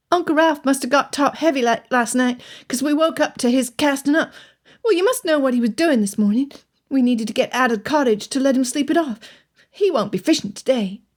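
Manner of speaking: the lad explaining plainly about his uncle; young boy voice. A narrator's reading. Taller boy telling Elizabeth about Uncle Ralph, boyish voice.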